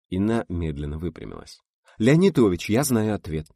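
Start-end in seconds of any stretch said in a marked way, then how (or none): 2.0-3.5 decisive. A narrator's reading. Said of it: "решительно заявила она".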